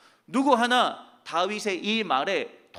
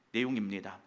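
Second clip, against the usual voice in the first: usual speaking voice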